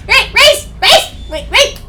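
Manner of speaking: loud, high calling. Acted. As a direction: shout after him. Calling after Reese.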